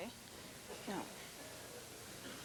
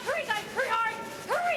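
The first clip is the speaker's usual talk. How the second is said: high urgent sweep calls; curling skip yelling; 10 semitones higher than usual. Real, not acted. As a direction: shout for the sweepers to scrub. Skip calling sweepers on ice.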